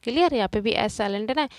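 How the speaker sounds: ordinary talk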